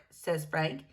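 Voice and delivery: usual speaking voice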